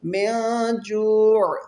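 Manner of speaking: slow, drawn-out tajweed chant; تلاوت کا انداز; Quran recitation. Shown as a demonstration. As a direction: stretch the long vowels like a reciter. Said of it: "یہاں پر اخفا ہوگا".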